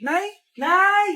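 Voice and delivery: ordinary talk, nothing marked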